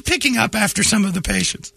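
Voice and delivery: very high dramatic narration; noir detective. Pretend. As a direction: read it like a kid's noir memoir. Kid's biography read dramatically.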